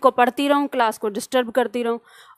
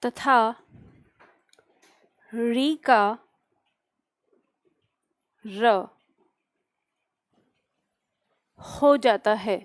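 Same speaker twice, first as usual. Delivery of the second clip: slow dictation with long pauses between phrases; 3 semitones lower, slower than usual. Acted. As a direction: dictate word by word for note-takers, drawing out each sound. Dictates definition to class. (धीमे-धीमे, रुक-रुक कर श्रुतलेख)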